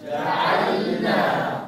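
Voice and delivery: جعلنا whispered very slowly; whispered drill. Shown as a demonstration. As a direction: breathe جعلنا softly as a drill. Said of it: "قولوها معي".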